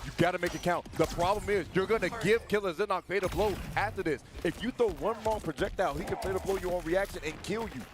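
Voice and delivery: high, loud, climbing play-by-play; hype commentary. Real, not acted. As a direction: blow the roof off the moment. Commentator peaks at big moment.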